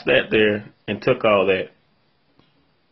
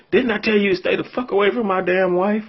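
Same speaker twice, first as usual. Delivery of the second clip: high mock angry husband voice, much higher; 9 semitones higher than usual. Retold angry husband yelling at him.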